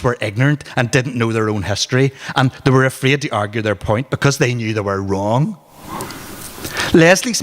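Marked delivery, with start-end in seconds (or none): none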